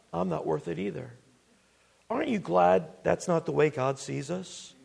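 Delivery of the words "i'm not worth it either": soft, defeated admission; quietly owning up to unworthiness; human second-guessing. Acted. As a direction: drop the volume as self-doubt takes over. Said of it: "But then with human reasoning".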